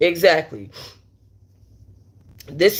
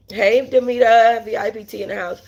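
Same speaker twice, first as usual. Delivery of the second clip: 1 excited shout-out; 5 semitones higher than usual. Real, not acted. Shout-out to a viewer.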